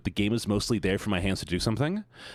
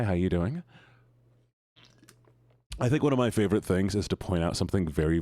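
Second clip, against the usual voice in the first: usual speaking voice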